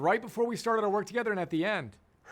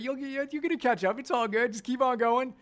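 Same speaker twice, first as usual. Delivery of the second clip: higher-pitched, light reassuring voice; breezy reassurance; 6 semitones higher than usual. Acted. Coach reassuring students who feel overwhelmed by the theory, higher-pitched reassurance. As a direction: lift the pitch and reassure them it's fine.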